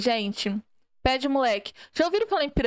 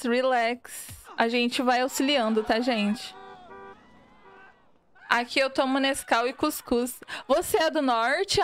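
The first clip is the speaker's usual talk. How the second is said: ordinary talk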